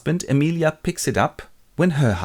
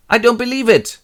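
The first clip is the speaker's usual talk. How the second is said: high, loud mock outburst; disbelieving; 12 semitones higher, 7 dB louder than usual. Jealous husband rejects Emilia's answer, angry disbelief. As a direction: refuse it angrily, hammed up.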